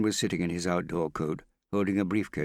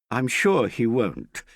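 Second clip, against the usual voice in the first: slow, higher, louder firm assurance; spirited; 7 semitones higher, 8 dB louder, slower than usual. A narrator's reading. Hester defends Harry to Morgan, spirited. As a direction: stand up for him with spirit. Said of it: "Hester said with spirit".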